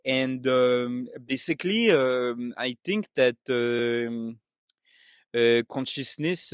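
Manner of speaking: hesitant French-accented English. Shown as a demonstration. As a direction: keep up a French accent, full of euhs. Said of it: "I'm going to do French".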